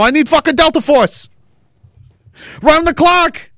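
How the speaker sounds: high, whiny mock rant of a frazzled politician; rattled politician. Politician begging Donnie for help, high and whiny. Hounded politician keeps complaining.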